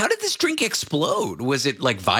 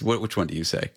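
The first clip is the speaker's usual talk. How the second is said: ordinary delivery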